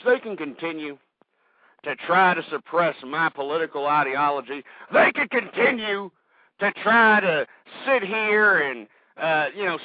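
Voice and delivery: ordinary delivery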